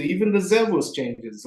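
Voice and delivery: ordinary talk, nothing marked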